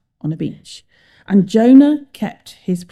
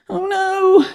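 high wail of dismay; groaning; 11 semitones higher than usual. A narrator's reading. Jonah struggling in the sea, groaning. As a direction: moan it high in alarm. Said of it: "Jonah groaned".

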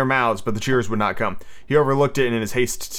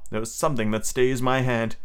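usual speaking voice

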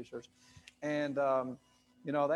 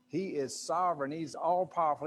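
normal talking voice

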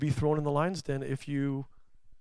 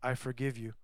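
much softer and gentle; tender forgiveness; 3 semitones lower, 11 dB quieter than usual. Retold or imitated. Scene: Jesus to someone who messed up, gentle forgiveness. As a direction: speak forgiveness softly, like a hand on the shoulder. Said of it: "you hear Jesus saying".

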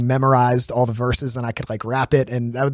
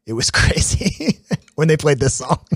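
slowed, laughing retelling of a cousin's awe; cousin quoted laughing. Retold or imitated. Retelling a cousin's concert story, with a laugh. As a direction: imitate him laughing as he remembers the show. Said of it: "he was like".

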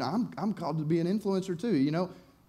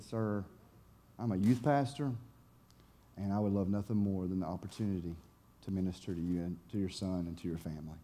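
soft, low, earnest offer; gentle sincerity; 7 semitones lower, 8 dB quieter than usual. Retold or imitated. Youth pastor offers help to a troubled father, gently. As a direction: lower the voice and pause between phrases.

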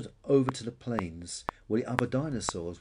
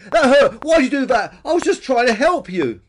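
very high, loud protest; hurt cry; 13 semitones higher, 14 dB louder than usual. A narrator's reading. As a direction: wail indignantly after being butted. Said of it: "cried Pe-, Billy".

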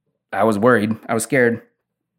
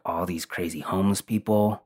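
usual speaking voice

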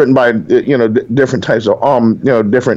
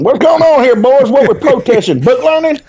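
put-on Southern accent; 12 semitones higher than usual. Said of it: "with my best Southern accent".

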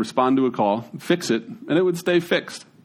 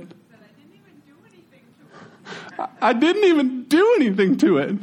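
put-on high, whiny kid voice, much higher; imitating a hurt kid; 12 semitones higher than usual. Pastor mimics young Grant stung by a wasp, whiny high kid voice. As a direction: play the stung little boy, teary and wronged. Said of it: "and he goes, uh, how do, how do you say it?".

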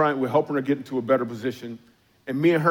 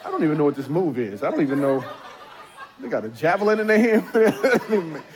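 laughing; 3 semitones higher than usual. Real, not acted. Pastor to congregation, cracking up at his own joke. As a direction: laugh through the punchline.

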